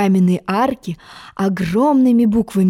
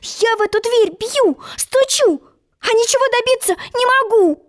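put-on very high, plaintive girl's voice; complaining; 13 semitones higher than usual. Alice knocking in vain at the door, high plaintive complaint. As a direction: whine it pitched far up. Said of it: "пожаловалась Алиса".

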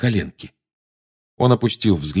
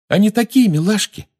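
put-on cooing girl voice; 13 semitones higher, 3 dB louder than usual. Girl cooing over patronus.

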